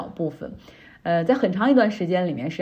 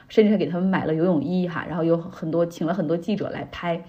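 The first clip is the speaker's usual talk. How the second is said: normal talking voice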